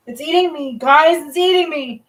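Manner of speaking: mock distress. Real, not acted. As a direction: complain dramatically about hair. Hair tangled, mock complaint.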